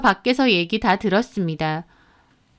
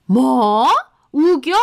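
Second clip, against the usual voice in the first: outraged retort; 4 dB louder, much slower than usual. Acted. Mother-in-law outraged at retort.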